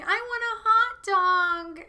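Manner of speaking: high, slow, breathy whining "hot dog"; Jennifer Coolidge. Retold or imitated. Doing Jennifer Coolidge begging for a hot dog, high and drawn out. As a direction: stretch "I want a hot dog" out in a high whine. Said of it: "Jennifer Coolidge goes around just going".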